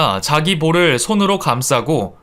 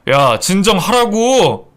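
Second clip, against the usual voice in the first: higher, drawn-out order; urgent command; 5 semitones higher, slower than usual. Acted. Son blocking his wife's hand, urgent and angry. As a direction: order her to stop, stretching it. Son stops wife hitting mother.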